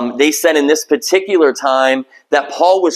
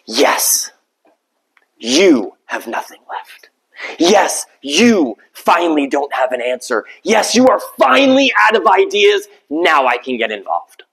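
gentle divine reply. Acted. God answering the weary believer.

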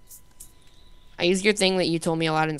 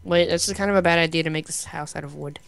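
ordinary talk, nothing marked

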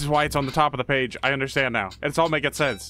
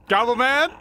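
higher, louder questioning call; 7 semitones higher, 5 dB louder than usual. Real, not acted. Player searching for Goblin Man, calling out. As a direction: call out with a big upward lilt.

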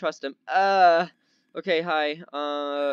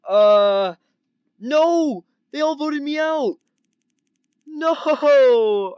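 high, loud cry of protest; drawn-out, high-pitched outraged "no"; dismayed wail; 9 semitones higher, 7 dB louder, much slower than usual. Real, not acted. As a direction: wail at being voted out. Gets voted out of the game.

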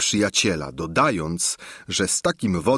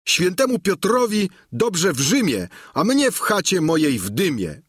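higher, earnest peasant voice; Sancho bidding farewell; 7 semitones higher than usual. A narrator's reading. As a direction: take leave with homespun dignity. (Sancho żegna się z godnością)

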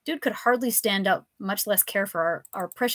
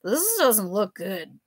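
slurred; slower than usual. Acted. Drunk professor threatening.

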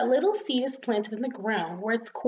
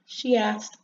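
ordinary delivery, nothing marked

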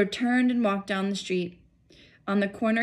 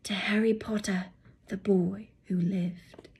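hushed voices. A narrator's reading. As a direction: whisper the toast reverently. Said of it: "saying in hushed voices".

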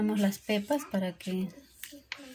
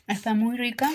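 mouth full. Real, not acted. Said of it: "Disculpen que estoy hablando con la boca llena".

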